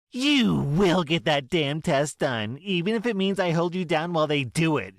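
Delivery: yelling mother. Acted. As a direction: threaten to hold her down. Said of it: "she yells".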